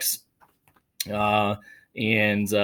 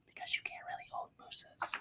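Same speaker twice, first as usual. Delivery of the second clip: whispered aside; 17 dB quieter than usual. Real, not acted. Teacher whispering the lesson to the class. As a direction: share a quiet secret.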